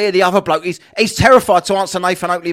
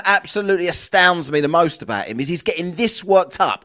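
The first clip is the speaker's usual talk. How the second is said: normal talking voice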